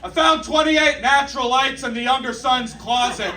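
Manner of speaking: high-pitched yelled announcement; hammed-up high holler; mock yell. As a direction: imitate a woman calling out across a site. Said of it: "dickhead salvage lady yells".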